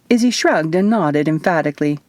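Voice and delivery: ordinary delivery, nothing marked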